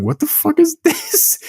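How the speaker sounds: very high-pitched mock disbelief, laughing; incredulous squeal. Friend retelling his reaction to a drilling subplot, incredulous squeal. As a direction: squeak it out in comic bewilderment.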